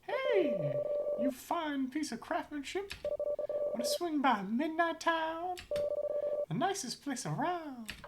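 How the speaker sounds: very high, slow smooth flirt; high schmoozing voice. Acted. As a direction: sweet-talk in a high voice. Voices a smooth-talking character.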